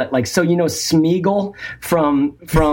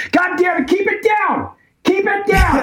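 put-on yelling at the kid offscreen, much higher; mock shout at kid. Caller pretends to yell at his noisy kid, mock shout. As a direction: fake angry yell to keep it down. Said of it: "Sorry about that".

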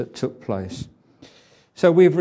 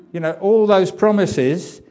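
higher, louder reproach; a mock scold about broken promises, higher and louder; 5 semitones higher, 7 dB louder than usual. Preacher imagining rebuking Peter, higher and louder. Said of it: "if it had been me".

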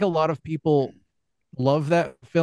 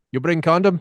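higher teasing Russian imitation; mock Russian accent; 4 semitones higher, 3 dB louder than usual. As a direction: prod a friend in a put-on Russian accent. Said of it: "dumbest date ever while we're on the Russians".

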